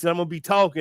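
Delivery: ordinary talk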